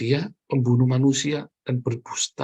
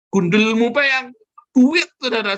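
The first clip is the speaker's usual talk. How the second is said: much higher, loud scolding burst; angry retort; 11 semitones higher, 7 dB louder than usual. Real, not acted. Preacher scolding the congregant about money, loud and high. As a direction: bark back at the excuse.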